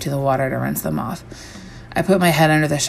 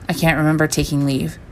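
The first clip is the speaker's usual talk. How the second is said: plain reading voice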